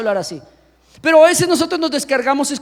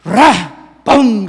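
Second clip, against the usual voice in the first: crash noises; 3 semitones lower, 6 dB louder than usual. Retold or imitated. Imitating a heavy fall.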